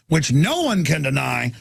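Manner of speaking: ordinary delivery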